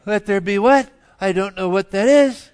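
loud, high incredulous back-talk; mock baffled voice. Imagined voice answering God's command to create light, mock bafflement. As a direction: play a voice loudly balking at an order. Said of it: "You didn't hear a voice go".

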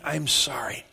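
breathy whispered "I'm sorry"; a throwaway apology under the breath. Retold or imitated. Mock half-hearted apology, whispered.

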